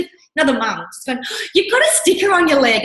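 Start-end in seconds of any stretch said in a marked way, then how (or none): 1.5-2.8 high, sugary mock politeness; sweet other mum. Mum stopping her at school, high sugary politeness.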